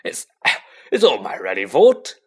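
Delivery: mildly tearful, breaking off and restarting; sobbing. A narrator's reading. Hagrid blames himself to Harry, sobbing. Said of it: "he sobbed".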